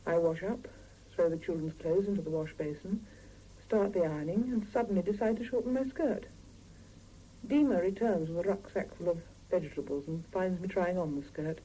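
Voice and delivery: low, calm first-person narration; story narration. A narrator's reading. Wife recounting her busy morning, calm narrating voice. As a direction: tell the day to the listener, low and even.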